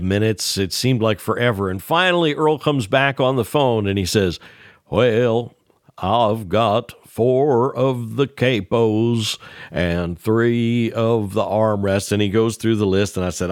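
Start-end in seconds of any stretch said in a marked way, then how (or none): 4.9-12.1 put-on very slow, deliberate old Carolina drawl; Earl's slow talk. Earl asking Ned his order, slow drawling voice. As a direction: stretch every word like Earl. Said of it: "Earl spoke very slowly and deliberately".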